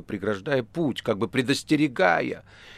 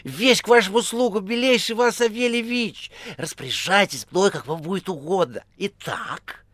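high, obsequious patter; crackling phone chatterbox; 10 semitones higher, 4 dB louder than usual. A narrator's reading. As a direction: gush servility in a high voice. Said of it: "трещала трубка". (угодливая высокая трескотня)

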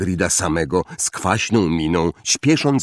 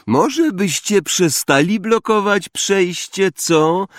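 cold, higher-pitched sneer; zimny Malfoy; 11 semitones higher, slower than usual. A narrator's reading. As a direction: drag it out high with icy contempt. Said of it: "zimny głos Malfoya". (zimnym, wysokim, pogardliwym głosem)

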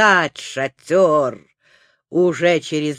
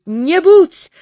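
desperate plea; 8 semitones higher, 7 dB louder than usual. A narrator's reading. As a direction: plead with all your heart. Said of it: "взмолился Незнайка". (громкая отчаянная мольба)

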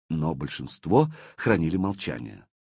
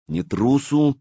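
"Не трусу" pitched high and louder; correcting; 7 semitones higher, 5 dB louder, slower than usual. A narrator's reading. Артём corrects his brother with a raised "Не трусу". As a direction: firmly correct with "Не трусу", raised above the usual voice. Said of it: "поправил Артём".

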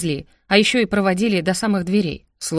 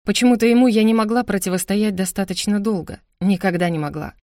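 usual speaking voice

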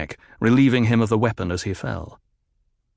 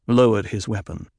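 plain reading voice

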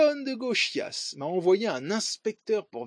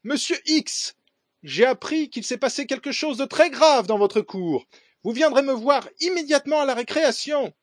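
much higher, louder curt principal; 8 semitones higher, 5 dB louder than usual. Retold or imitated. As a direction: snap it out like a stern principal. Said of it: "Elle me dit sèchement".

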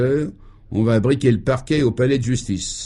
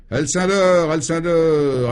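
calling out; 7 semitones higher, much slower than usual. Acted. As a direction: call a colleague over. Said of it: "appela-t-il".

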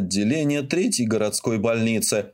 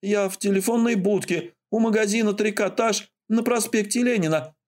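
anxious urgency; 9 semitones higher than usual. Acted. Anxious caller gives address.

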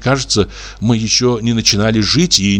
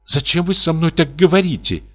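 horrified; 8 semitones higher than usual. A narrator's reading. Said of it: "как бы в ужасе".

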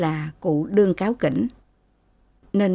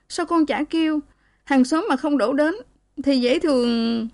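high-pitched motherly fretting; acted anguished questioning; distressed reproach; 8 semitones higher than usual. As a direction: scold out of pity, pitched up. Distressed mother reproaches beaten daughter.

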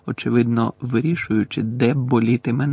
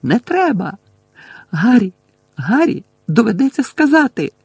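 high, loud desperate pleading; frantic high-pitched appeal; screeching plea; 9 semitones higher, 6 dB louder than usual. A narrator's reading. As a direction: beg urgently in a high raised voice. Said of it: "заверещала надтріснутим голосом Герміона".

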